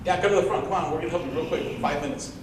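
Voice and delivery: insistent teacher, lightly voiced; insisting teacher. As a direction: push him up to the front cheerfully.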